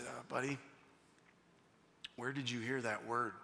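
put-on hushed, low, careful question to a child; very soft, low voice of a tactful dad; gentle questioning. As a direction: ask softly, getting down to a little one's level. Gets down to ask his son gently.

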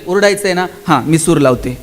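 a hint of a patient teacher; coaxing teacher. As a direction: steer a noisy child, dropping the pitch. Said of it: "त्याला सांगावं लागेल".

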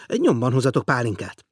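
enthusiastic cry. A narrator's reading. Millionaire eagerly promises brandy to his guest, enthusiastic cry. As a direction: call out the order with delight. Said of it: "kiáltott lelkesen mister Teo".

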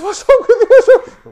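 very high, loud laughing tease; laughing shout. Retold or imitated. Laughing friends teasing the serious group, high laughing shout. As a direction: shriek it out while laughing.

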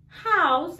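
normal talking voice

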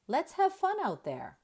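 upbeat coach encouragement; cheery coach. A narrator's reading. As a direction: cheer the team on. Coach's cheerful quote in story.

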